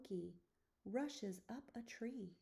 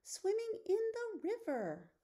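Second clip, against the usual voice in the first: much higher, louder cub voice calling out; eager lion cub call; 10 semitones higher, 5 dB louder than usual. Acted. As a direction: call it out like an excited little cub. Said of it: "roars the lion cub".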